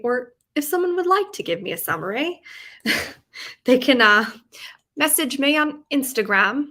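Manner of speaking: put-on playful, amateurish British accent. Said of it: "I'm doing my British accent again".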